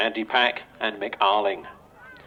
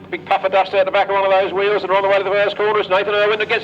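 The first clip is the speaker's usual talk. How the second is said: louder, higher play-by-play building as the tapes fly; live race commentary; 7 semitones higher, 6 dB louder than usual. Real, not acted. Announcer calling the race start to the crowd, excited play-by-play. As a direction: call the start as it happens, rising with the action.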